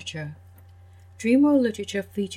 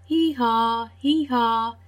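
higher, louder hee-haw; donkey bray; 3 semitones higher, 4 dB louder, much slower than usual. Acted. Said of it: "The donkey said".

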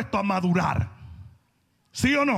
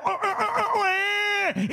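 mock baby crying, pitched up; hammed-up infant cry for the breast; baby wail; 10 semitones higher, slower than usual. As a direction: wail like a hungry baby at night. Imitates cold baby crying.

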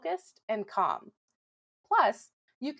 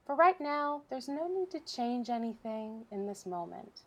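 softer, unhurried relaxation voice with long pauses; guided meditation; 8 dB quieter than usual. Real, not acted. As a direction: guide listeners gently through breathing, leaving room between phrases. Leads a breathing exercise.

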